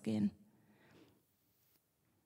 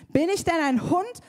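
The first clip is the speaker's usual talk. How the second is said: higher, a bit louder, bellowing challenge; roaring giant; 8 semitones higher, 3 dB louder than usual. A narrator's reading. Goliath bellows at David with a stick, higher and louder. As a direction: roar it down at the boy. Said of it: "brüllte Goliath ihn an".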